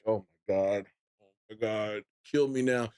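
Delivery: low, understated groaning despair; mock despair. Fan re-enacts watching the Bills lose, mock despair. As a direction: sink into defeated, muted dismay. Acting despair at a bad game.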